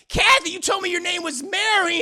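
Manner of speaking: high, loud mock accusation; acted indignation. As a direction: accuse her as the deceived lover. Lover confronts lying woman.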